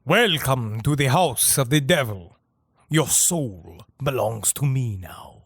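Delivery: low, slow sinister villain; cinematic villain. Pretend. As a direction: deliver it like a horror film baddie. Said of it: "cinematically said".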